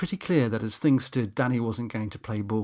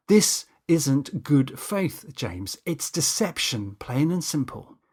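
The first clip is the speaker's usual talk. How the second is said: slow emphatic; 2 semitones higher, slower than usual. Acted. Danny lecturing James slowly.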